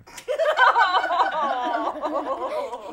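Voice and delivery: a big, high burst of real laughter; cracking up at the nephews joke; loud laugh. Real, not acted. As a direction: break up laughing at your own joke.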